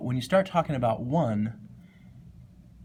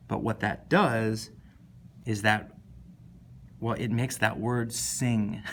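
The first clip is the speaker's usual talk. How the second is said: normal talking voice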